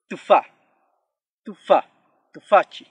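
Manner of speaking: tufa, tufa, tufachi loud, the first vowel barely there after T; vowel after consonant. Shown as a demonstration. Teacher gives examples with T, loud spaced-out tufa, tufa, tufachi. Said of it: "pronuncia este sonido".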